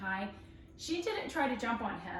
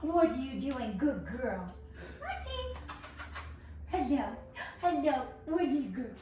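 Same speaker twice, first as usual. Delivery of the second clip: really happy greeting; 2 semitones higher than usual. Shown as a demonstration. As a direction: gush over the dog to get her to jump. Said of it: "I'm just gonna talk to her really happy".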